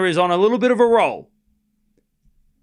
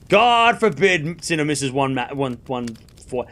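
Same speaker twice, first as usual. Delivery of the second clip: ordinary talk, nothing marked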